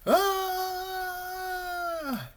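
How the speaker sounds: long high-pitched panicked scream; yelling. A narrator's reading. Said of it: "Rabbit ran around the house yelling".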